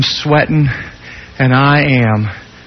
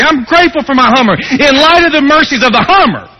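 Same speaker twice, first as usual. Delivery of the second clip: high, loud, rushing earnest protest; mock zealous gratitude; 12 semitones higher, 5 dB louder than usual. Preacher mocking a believer, pushing his gift earnestly. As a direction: ham up the pious excuse-maker.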